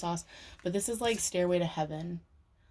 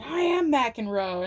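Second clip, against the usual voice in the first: loud, slow and proud; defiant declaration; 6 semitones higher, 6 dB louder, slower than usual. Retold or imitated. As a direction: declare it like a champion. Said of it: "And Cameron Diaz is like".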